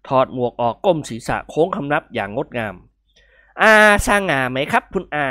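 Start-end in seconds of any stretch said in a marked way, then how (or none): 3.2-5.3 slow, smiling boast to his uncle; pleased with himself, drawn out; slightly higher, slow and proud; smiling young man. A narrator's reading. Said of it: "กิมหงวนพูดยิ้มยิ้ม".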